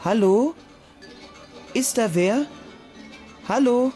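nervous call. Acted. Junge ruft ängstlich ins Dunkel.

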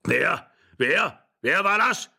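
startled, escalating questions; calling out. A narrator's reading. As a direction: call out in alarm from the doorway. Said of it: "rief der Alte von der Tür her".

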